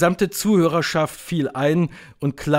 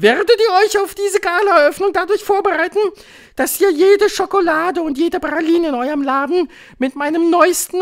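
very high, loud witch voice; piercing high proclamation; commanding Grand High Witch; 9 dB louder than usual. A narrator's reading. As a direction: shout it as a domineering witch leader.

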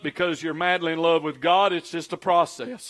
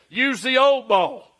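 higher, slow heavenly command; ringing voice from above; 5 semitones higher, 3 dB louder, slower than usual. Acted. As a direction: ring it out from on high, drawn out. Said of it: "The voice rang out again".

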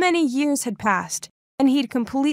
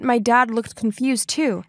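usual speaking voice

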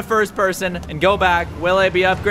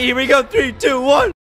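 raised, building launch count; excited countdown; 4 semitones higher than usual. Real, not acted. Gamer about to test a semi-truck, excited countdown.

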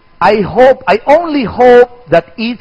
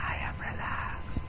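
slow, much softer whisper; 23 dB quieter, slower than usual. Shown as a demonstration. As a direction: slowly breathe it with no voice.

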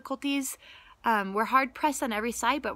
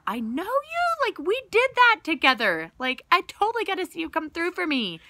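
excited gratitude; 8 semitones higher, 5 dB louder than usual. Retold or imitated. Speaker telling God he carried her through, gushing with excitement. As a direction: gush like you're thrilled a friend came through. Voicing close trust in God.

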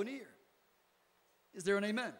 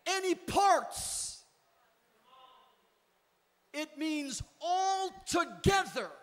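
rising, slowing preacher's build; escalating repetition; 4 semitones higher, 5 dB louder, slower than usual. Acted. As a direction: build it louder line by line.

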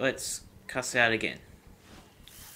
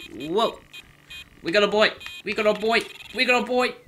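rising excited yells, each higher; excited shouting; 9 semitones higher, 7 dB louder, faster than usual. Real, not acted. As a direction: shout it with growing glee. Excited shout at fish catch.

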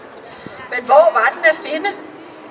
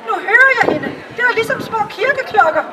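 higher, quicker delighted courtier voice; enraptured provost; 6 semitones higher than usual. Acted. The provost mistakes the frogs for the bird, higher and quick. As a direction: gush over the little bells.

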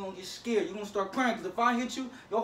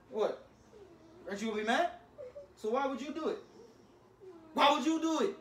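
upset scolding; 6 semitones higher than usual. Real, not acted. Big brother scolding after sister slapped.

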